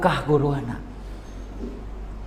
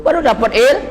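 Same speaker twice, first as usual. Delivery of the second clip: rising shout; 14 semitones higher than usual. Real, not acted. Climax of the sermon.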